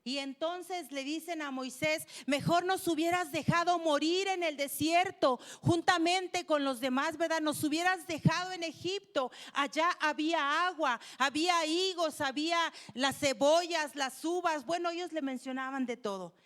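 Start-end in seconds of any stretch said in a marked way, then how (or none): 2.3-7.2 loud, raised-pitch protest; complaining. Acted. Thirsty Israelites blame Moses in the desert, loudly complaining. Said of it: "quejándose contra Moisés". 7.4-14.6 insistent, raised-voice grievance; complaining. Acted. The people remind Moses of Egypt's plenty, complaining insistently. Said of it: "quejándose contra Moisés".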